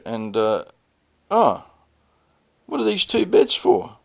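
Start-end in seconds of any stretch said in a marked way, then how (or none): 1.3-4.0 high mock-innocent wife voice; a lightly voiced wife playing innocent; mimicking the wife. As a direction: pitch it up like his wife feigning surprise. Mimicking wife's surprised question.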